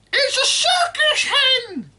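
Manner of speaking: put-on high, loud screeching old woman; cranky grandma yelling from the roof; shouting grandma. A narrator's reading. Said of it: "Grandma shouted from the rooftop".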